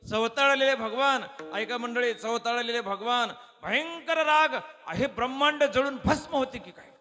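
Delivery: high, loud build about the raging god; fiery preaching. A narrator's reading. Preacher tells the crowd of the god's rage, fiery. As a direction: stir the audience with the god's fury.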